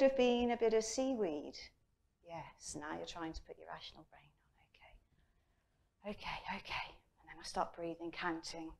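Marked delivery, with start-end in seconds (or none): 2.3-6.1 soft unvoiced whisper to herself; self-calming whisper. 6.1-6.9 whispered self-soothing; quiet soothing okays. Retold or imitated. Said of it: "calming herself in water".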